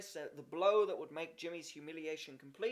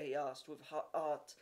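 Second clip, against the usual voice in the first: ordinary delivery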